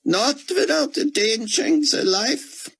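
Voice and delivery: a broken scholar clinging to scripture; grieving Israeli scholar. A narrator's reading. Grieving scholar tells Buck his faith, high and squeaked.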